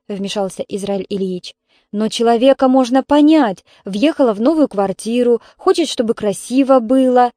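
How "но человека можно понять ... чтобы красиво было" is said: ordinary delivery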